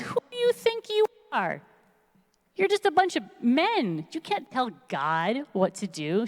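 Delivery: high-pitched indignant retold scolding; mock yelling. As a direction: start very high in mock outrage, then ease down. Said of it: "she's yelling at all these".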